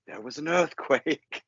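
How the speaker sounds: a hint of an unplaceable accent; George's odd accent. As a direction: mimic his strange delivery slowly. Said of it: "the way he says".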